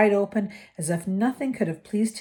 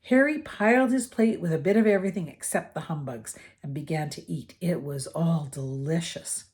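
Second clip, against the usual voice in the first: ordinary delivery, nothing marked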